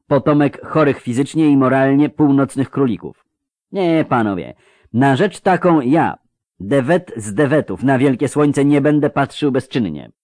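mildly bristling grating character; a lightly voiced indignant retort. A narrator's reading. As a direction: declaim with a touch of indignation. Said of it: "zaperzył się zgrzytliwy".

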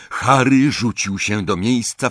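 ordinary delivery, nothing marked

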